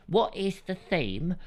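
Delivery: quoting caller. Retold or imitated. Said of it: "Simon said".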